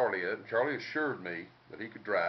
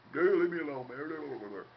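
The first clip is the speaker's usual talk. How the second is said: put-on drunk grumble; 5 semitones higher, slower than usual. Drunk Terry waved off.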